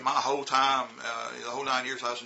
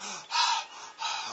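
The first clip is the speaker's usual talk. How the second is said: breathless gasp; 7 semitones higher than usual. Retold or imitated. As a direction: gasp for air as if you just ran there. Student runs up out of breath.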